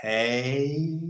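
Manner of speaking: drawn-out, soft northern Swedish accent; mock a northern Swede, stretching the words. Shown as a demonstration. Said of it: "in the northern".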